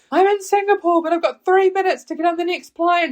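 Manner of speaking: high, loud rushed panic on the phone; panicked squeal. Retold or imitated. To mum on the phone, near-missing the flight: high, loud panicked squeal. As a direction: blurt it out as if the gate is closing.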